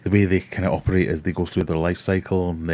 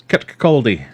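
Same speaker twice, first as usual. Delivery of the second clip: Kirkcaldy in a louder, hammed-up Teuchter Scottish accent; mock Scottish accent; 5 semitones higher, 9 dB louder, slower than usual. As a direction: imitate the classmate's rural Scottish accent saying Kirkcaldy. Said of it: "for my terrible accent".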